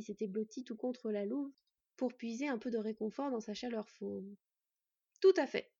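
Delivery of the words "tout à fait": firm, raised, louder confirmation; convincing. A narrator's reading. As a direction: reassure with conviction. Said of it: "d'un ton suffisamment convaincant pour rasséréner".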